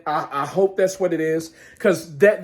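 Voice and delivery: ordinary delivery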